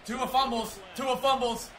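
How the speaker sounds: loud high excited shout; yelling at the screen. Real, not acted. Gamer reacts to fumble.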